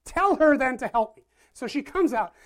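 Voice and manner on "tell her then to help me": sharp, loud reprimand to the Lord; scolding big sister. Acted. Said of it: "she scolds God".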